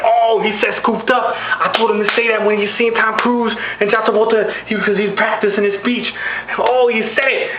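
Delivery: high, excited bystander hype. Acted. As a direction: imitate a rapper crowing from the background. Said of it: "[name] in the background like".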